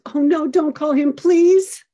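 put-on a child begging, rising high on please; pleading child. Son begging mom not to call Jeremy, pleading. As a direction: beg her not to make the call.

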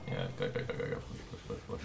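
urgent whispered go go go; hushed, pressing whisper. Real, not acted. Urgent whispers mid-fight.

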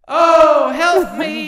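high, loud call through an echo effect; hammed-up echoing plea; down a hole. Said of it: "the mixing board thing with the hole".

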